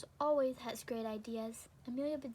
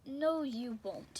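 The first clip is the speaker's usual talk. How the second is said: slow refusal; 8 dB louder, much slower than usual. Acted. Mister Rogers refuses, drawn out.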